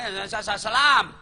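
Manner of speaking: stammering salam that bursts out loud at the end; sputter, then blurt salam; rushed stuttered salam shouted at the end; hurried salam. Retold or imitated. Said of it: "Orang mau masih bicara".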